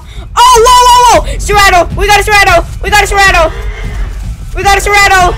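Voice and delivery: loud, high, thrilled yelling at the sight; excited shouting. Real, not acted. Gamer spotting a rare creature, yelling with excitement. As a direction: shout it out like you just struck gold.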